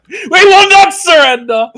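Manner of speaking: high, loud battle cry; roaring rallying speech. Acted. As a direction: roar it to the troops. Defiant rally.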